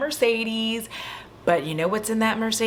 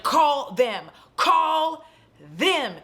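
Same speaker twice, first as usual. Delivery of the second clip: insistent; 8 semitones higher, much slower than usual. Retold or imitated. Girl insists in retold argument.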